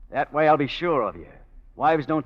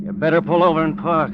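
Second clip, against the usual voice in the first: commanding; 5 semitones higher, 9 dB louder than usual. Acted. Gangster ordering driver to stop.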